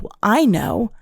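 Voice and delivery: sulky mock accusation, drawn out; lightly voiced petty accuser; petty high-pitched whine. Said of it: "they'll be like".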